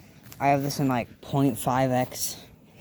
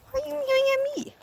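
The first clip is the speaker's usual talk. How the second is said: pet voice; faster than usual. Real, not acted. Asking the meowing cat, very high squeaky. Squeaky voice then plain scold.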